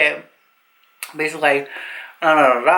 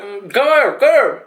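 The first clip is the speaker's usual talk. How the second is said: a raised, pitched-up "come here", mock yelling; guard's mock shout, going higher; 3 semitones higher than usual. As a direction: call her over like a pushy guard. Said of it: "screaming at her".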